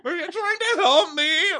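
squeaky high tearful imitation; hammed-up wounded wail; mock sobbing. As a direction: plead it in a very high crybaby voice. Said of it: "she's sobbing".

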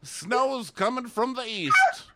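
high, loud mock old-timer; playful old man impression; hammed-up old man. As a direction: boast like a cocky old codger. Voicing old man joking.

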